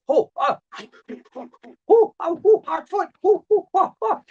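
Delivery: high frantic oh oh oh; panicked yelps. Acted. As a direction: yelp as your roots catch fire. Tree catching fire, hot foot.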